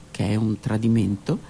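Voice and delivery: plain reading voice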